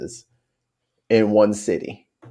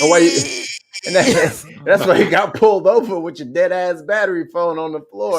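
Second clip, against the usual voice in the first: laughing; 6 semitones higher than usual. Real, not acted. Laughing while roasting friend.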